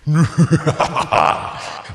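a hammed-up villain cackle; evil gloating laugh. Zookeeper gloating over Meilon, hammed-up villain laugh. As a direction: laugh at your old victim.

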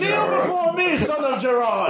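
booming mock villain command; slow theatrical villain menace. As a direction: lord it over him like a dark villain. Mock villain addressing Gerard.

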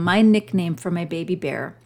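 ordinary delivery

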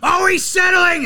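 high, loud mock yell of oh he's settling; commentator complaint. Mimicking the Hornets caller moaning at Embiid, high loud yell. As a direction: whine it like an outraged homer announcer. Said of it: "he's like".

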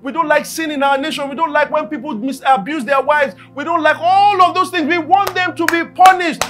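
loud, rising preacher's call about God's might; full-force preaching. Acted. Preacher to congregation, thundering about God's mighty wind and fire.

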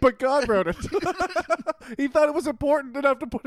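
high, giggling tease; laughing. Real, not acted. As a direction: laugh through the words. Teasing while laughing.

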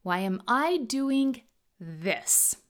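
slow, with "this" set apart at the end; stress on THIS. Shown as a demonstration. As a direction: hold back, then land on "this". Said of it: "each variation puts the emphasis on a different word".

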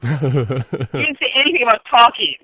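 loud, drawn-out mock protest; mock alarm. Drinks companion reacting to unexpected talking, mock alarm. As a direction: balk as if you've been tricked. Acting a friend ambushed by talk.

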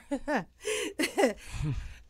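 short high burst of laughter; embarrassed laugh. Real, not acted. Laughing about her past with partner.

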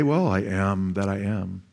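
low, slow, soft pretend answer; mock self-introduction. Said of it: "none of us would say".

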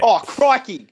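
high, loud Aussie exclamation; hammed-up Australian cry of surprise; Australian accent demo. Shown as a demonstration. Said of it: "you guys say something and I'll just try to mimic it".

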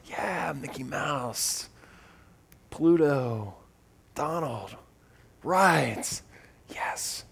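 soft, drawn-out dreamy murmur; awed kid. Acted. Poison-ivy kid finally among Disney characters, quietly thrilled. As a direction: savour each name under your breath, slowly. Child savouring the park.

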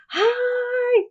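put-on very high, bright child's squeal; little girl greeting. Little girls running up to greet the volunteers. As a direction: call out like an excited little girl.

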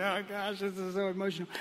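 choked up. Retold or imitated. Preacher retelling getting in the truck, choked up. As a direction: imitate being overcome, quietly. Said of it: "I can't breathe".

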